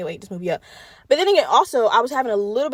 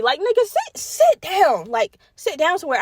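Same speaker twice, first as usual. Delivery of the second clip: exasperated scolding; 6 semitones higher than usual. Real, not acted. Scolding a movie character.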